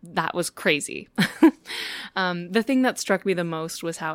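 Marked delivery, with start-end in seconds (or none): 1.1-2.1 laugh. Real, not acted. Laughing after crazy moment.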